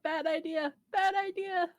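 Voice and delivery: hammed-up yelled warning; mock yell. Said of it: "Mary yells out".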